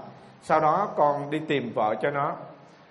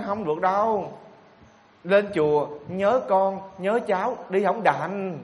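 quoting the woman; 4 semitones higher, slower than usual. Retold or imitated. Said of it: "Cô đó nói".

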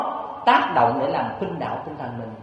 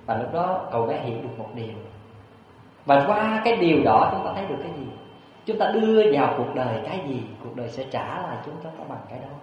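ordinary delivery

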